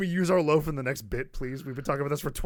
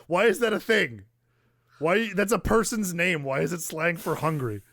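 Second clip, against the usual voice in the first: high, loud mock-enraged rant; mock outrage; 7 semitones higher, 6 dB louder than usual. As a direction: play up the outrage at the slang, higher and louder. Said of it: "it's gonna enrage me".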